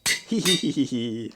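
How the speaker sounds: a goofy cartoon giggle; cartoon laugh. Real, not acted. Naming armor Skullcap, laughs.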